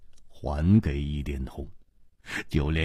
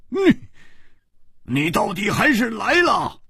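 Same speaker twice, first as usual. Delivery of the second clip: much higher, exclaimed at full voice; loudly; 10 semitones higher than usual. A narrator's reading. Swordsman echoes her words, calling out loudly. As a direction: call it out in open surprise. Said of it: "大声说".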